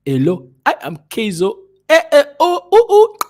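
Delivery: high, loud, sing-song African English, rising into high calls; simplified African English accent. Retold or imitated. Talking to Africans so they understand, exaggerated African English accent. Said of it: "My English starts becoming".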